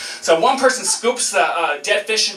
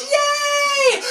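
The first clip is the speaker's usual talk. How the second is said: high squealing yay; cheering yay. Retold or imitated. Group on the boat cheering the shark fin, high squeal. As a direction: cheer like a crowd seeing a shark.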